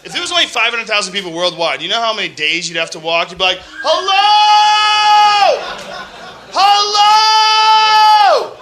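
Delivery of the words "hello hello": high, long shouted "Hello!" across the hills; calling out. Pretend. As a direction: call into the distance, stretched and sung. Calling out across empty land.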